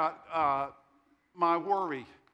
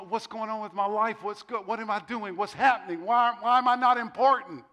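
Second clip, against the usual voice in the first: mock panicked questioning; 5 semitones higher, 7 dB louder than usual. Preacher voicing himself at twenty-five, questioning his life in rising panic. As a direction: build from raised pitch to loud, high exasperation.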